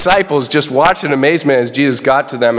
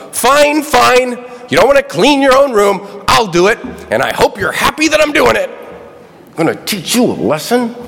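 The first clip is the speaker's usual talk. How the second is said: exasperated mother; 9 semitones higher than usual. Retold or imitated. Pastor mimicking an exasperated mother cleaning up. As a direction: nag like a worn-out mom. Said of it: "like the exasperated mother".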